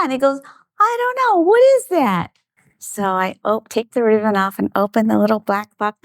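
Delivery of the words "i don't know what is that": high, put-on puzzled voice; feigned puzzlement. Husband teasing wife about a surprise box, mock puzzled. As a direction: imitate someone playing innocent.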